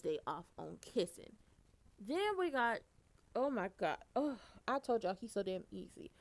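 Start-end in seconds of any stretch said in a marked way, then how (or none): none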